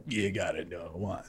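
deadpan low mock of common advice; everybody's advice. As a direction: mumble the cliché deep and quiet. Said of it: "everybody's like".

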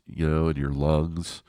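normal talking voice

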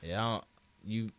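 normal talking voice

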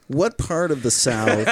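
ordinary talk

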